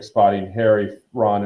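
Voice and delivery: ordinary delivery, nothing marked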